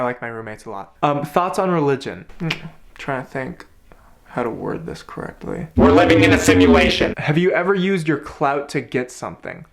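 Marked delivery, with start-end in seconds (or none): 5.8-7.1 low, loud doom-laden voice; ominous pronouncement. Pretend. Answering the question on religion, low loud ominous intoning.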